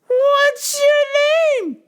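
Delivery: put-on very high, loud and slow old lady; old lady caller. Old lady caller demanding on phone, very high and slow. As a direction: quaver it out like a frail old lady.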